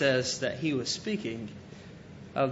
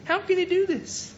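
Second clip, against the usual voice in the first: high, baffled voice of the doubting crowd; 10 semitones higher than usual. Retold or imitated. Doubting listeners ask how he could say this, high and baffled. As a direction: squeak out their disbelief, much higher. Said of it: "again, they said".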